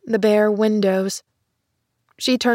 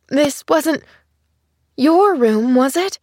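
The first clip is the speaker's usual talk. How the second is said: fearful; 5 semitones higher, 3 dB louder than usual. A narrator's reading. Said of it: "Then fearfully".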